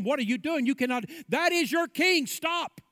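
urgent guards shouting a warning; guards yelling. A narrator's reading. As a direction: yell at the intruder to stop. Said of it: "they yell at him".

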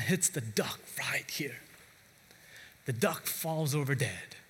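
breathless storyteller's whisper; hushed whisper. A narrator's reading. Preacher whispering as Johnny hits the duck. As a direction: whisper the moment of the shot. Dramatic moment of the shot.

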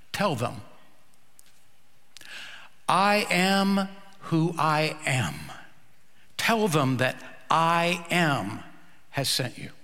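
a hint of a solemn God, slow; lightly voiced God, slow and grave. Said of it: "And God said".

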